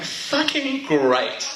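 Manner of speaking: slow, dry sarcastic drawl; deadpan disappointment. Retold or imitated. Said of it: "I'm like".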